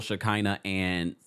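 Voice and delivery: ordinary talk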